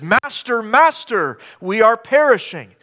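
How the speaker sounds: repeated high, loud call; urgent cry. Retold or imitated. Disciples waking Master in the storm, high and loud. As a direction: call out twice in panic. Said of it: "it says".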